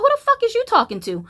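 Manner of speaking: high, loud, fast angry yell; fast high furious clapback; furious retort. Retold or imitated. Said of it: "I was like".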